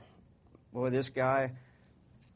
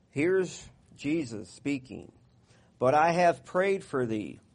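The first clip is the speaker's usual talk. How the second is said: raised, measured Bible reading voice; scripture reading; 3 semitones higher, 5 dB louder, slower than usual. Acted. Pastor reading Luke aloud to his congregation, measured and raised. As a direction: proclaim the verses slowly to the church.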